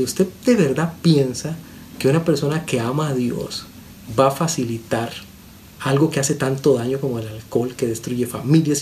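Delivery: ordinary talk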